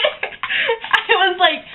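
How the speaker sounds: slow words broken by real laughter; real giggles, drawn out; laughing. Real, not acted. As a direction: laugh your way through it. Laughing at the arrest story.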